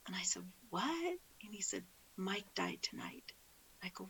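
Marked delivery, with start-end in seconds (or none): 0.7-1.2 soft, high-pitched disbelieving question; hushed startled question; retold shock. Retelling news of brother's death. 2.2-3.3 very soft, hushed delivery; subdued, near-whispered retelling; retold grave news. Retelling news of brother's death.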